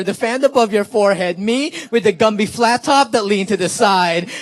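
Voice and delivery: rhythmic poetry-slam delivery with repeated refrain; spoken word poem. Acted. Poet performs a nineties love poem for students, spoken word.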